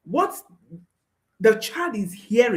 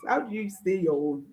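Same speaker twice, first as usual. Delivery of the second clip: ordinary talk, nothing marked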